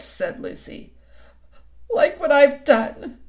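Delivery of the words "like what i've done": loud, slow, high wail; tearful confession. A narrator's reading. The faun answers Lucy about his deed, wailing. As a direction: own up miserably.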